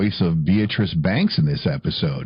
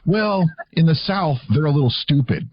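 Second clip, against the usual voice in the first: mock teacher verdict; 7 semitones higher than usual. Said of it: "my teacher's honest opinion".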